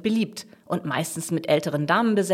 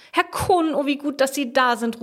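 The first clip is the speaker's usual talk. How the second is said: high-pitched, flustered calling; agitated cry of relief, pitch raised; breathless call; 8 semitones higher than usual. A narrator's reading. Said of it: "ruft sie atemlos".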